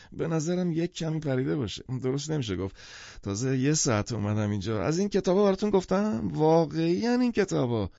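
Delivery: tipsy ramble rising to excitement; half-drunk man. Acted. Half-drunk man returns to his books, tipsy and excited. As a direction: drift along, then get excited all over again.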